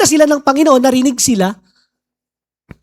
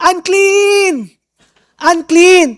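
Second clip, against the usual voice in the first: drawn-out, higher warning cry; mock shout; 6 semitones higher, much slower than usual. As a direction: call out like an outcast warning people off. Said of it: "sisigaw ka".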